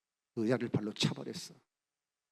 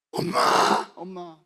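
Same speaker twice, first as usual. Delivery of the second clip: crying for mother; 6 semitones higher, 10 dB louder, much slower than usual. Retold or imitated. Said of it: "하고 울었어".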